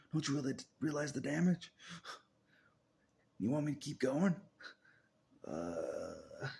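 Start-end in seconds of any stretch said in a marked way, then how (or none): 0.1-4.3 loud, high pleading anger; angry demand. Real, not acted. Confronting God about childhood damage, angry.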